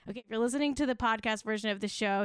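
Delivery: fast, tossed-off podcast aside; rushed. Shown as a demonstration. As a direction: rattle it off quickly. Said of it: "hey, Jack, throw that back up".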